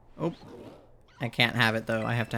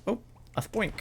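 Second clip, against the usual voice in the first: delighted surprise; 9 semitones higher, 5 dB quieter, faster than usual. Real, not acted. Spots a cute Pokemon.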